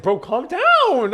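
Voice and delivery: high, drawn-out mock plea; exasperated reassurance. Customer to apologizing server, exaggerated exasperated pleading.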